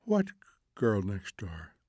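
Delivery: soft drowsy question, slowing and sinking; almost asleep. A narrator's reading. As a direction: ask it half asleep, trailing downward. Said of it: "She was almost asleep".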